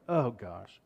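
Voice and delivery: low, soft, sighed-out words; deep sigh. Acted. Jesus sighing at the Pharisees' demand, deep sigh. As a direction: breathe it out in weary acted exasperation. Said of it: "He sighed deeply".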